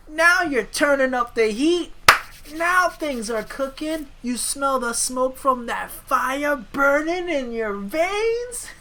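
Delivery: high, drawn-out mock pep talk; over-the-top wrestling mentor egging on a kid; hammed-up hype man. Hyping rival with fire puns.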